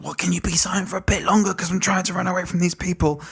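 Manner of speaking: high, hurried mock plea; Brian. Acting Brian pleading with hermit.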